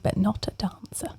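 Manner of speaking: teasing stage whisper; whispered aside. Real, not acted. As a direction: drop to a whisper for the joke. Teasing aside about cohost.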